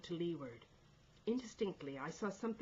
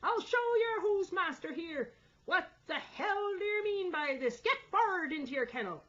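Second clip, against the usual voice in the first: high, loud roar at the crew; angry shout; 13 semitones higher, 9 dB louder than usual. A narrator's reading. Mate ordering the men forward, angry shout. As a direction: bellow it like a bully in charge. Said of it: "he shouted".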